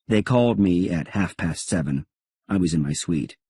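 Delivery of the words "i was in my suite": plain reading voice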